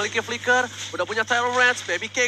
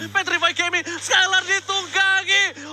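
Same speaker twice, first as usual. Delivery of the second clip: excited; 8 semitones higher, slower than usual. Real, not acted. Caster climax, laughs.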